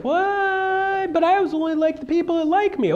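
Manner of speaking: high, squeaky disbelief; very high incredulous voice; mock shocked agent. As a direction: squeal it like a stunned rookie. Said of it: "You're like".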